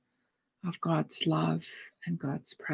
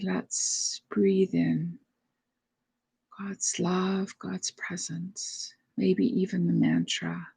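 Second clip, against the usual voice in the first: guided meditation. Real, not acted. Leads breathing meditation.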